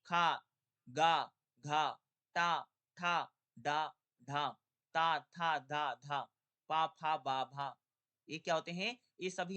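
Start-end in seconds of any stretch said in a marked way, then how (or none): 0.0-7.8 पा, फा, बा loud and slow, one by one; consonant demo. Shown as a demonstration. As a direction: clear loud syllables for the class. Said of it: "खास तौर से देखिएगा स्पर्शी व्यंजन जैसे". (अक्षरों का ज़ोरदार उच्चारण)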